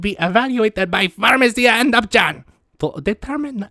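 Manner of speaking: high, loud cartoon mad scientist reading a letter; Doctor Doofenshmirtz. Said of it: "sounds like Doctor Doofenshmirtz".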